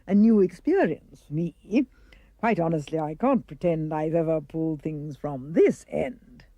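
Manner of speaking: lightly voiced dentist, savouring the job; a touch of low, theatrical glee; gleeful dentist. A narrator's reading. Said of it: "he cried with obvious glee".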